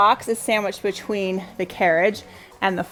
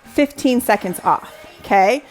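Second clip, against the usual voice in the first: upbeat workout coaching; aerobics instructor; 3 semitones higher than usual. Acted. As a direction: pump the class up, keep cueing. Leads workout interval live.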